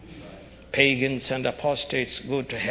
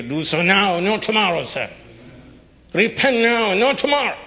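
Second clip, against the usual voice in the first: shouting, higher and louder pleading; doomsday street preacher; 8 semitones higher, 7 dB louder than usual. Acted. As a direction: cry out the warning at full force.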